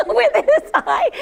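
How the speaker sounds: laughing hard mid-story. Real, not acted. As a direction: squeal it through helpless laughter. Laughing retelling the eye moment.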